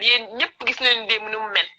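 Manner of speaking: usual speaking voice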